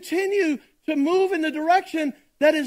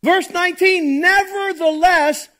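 forceful preaching; 6 dB louder than usual. Acted. Pastor reading scripture to the congregation, in a loud preaching voice. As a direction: proclaim each phrase to the whole room.

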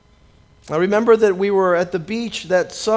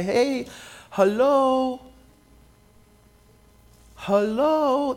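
mocking hello; 4 semitones higher, much slower than usual. Mocking the woman's claim.

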